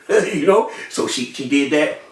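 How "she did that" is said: plain reading voice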